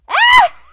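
loud, high witch cackle. Shown as a demonstration. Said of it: "This is a Halloween witch".

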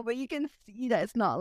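usual speaking voice

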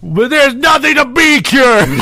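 hammed-up aggressive protest; mock in-your-face shout. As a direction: imitate yelling right in someone's face. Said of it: "right in his face".

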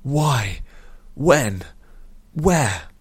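loud, high, sharp questions; shocked demanding. A narrator's reading. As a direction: fire questions in shock, each separate. Shocked hero demands answers.

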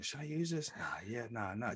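very soft muttering to himself; hushed, hesitant thinking aloud; contemplating. Retold or imitated. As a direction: weigh it up under your breath. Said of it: "I'm, like, contemplating".

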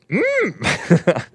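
short high creature "mm"; high Yoda-like hum. Retold or imitated. Host joking about sounding like Yoda, high creature hum. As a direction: hum high like a little wise alien. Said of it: "people are saying it sounds like Yoda".

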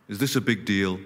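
dropped low, deadpan question; imitating the president-elect. Quoting Trump's first words to them, low deadpan. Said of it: "The first thing he said was".

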